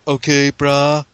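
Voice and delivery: put-on California surfer accent. A California surfer says okay brah with a California drawl. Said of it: "the same accent to us".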